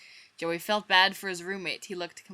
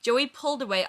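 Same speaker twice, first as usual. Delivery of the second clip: ordinary delivery, nothing marked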